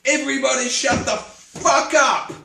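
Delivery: shouted high and loud across a noisy room; retold yell. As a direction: bellow to silence everyone. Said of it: "walk into the center of the room and yell".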